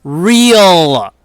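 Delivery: REAL stretched long and loud; exasperated emphasis. Real, not acted. Streamer protesting arrests over fictional characters, exasperated emphasis.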